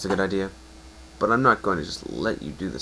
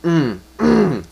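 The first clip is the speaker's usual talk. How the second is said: hum; 8 semitones higher, 11 dB louder than usual. Acted. Hug, voiced contented hum.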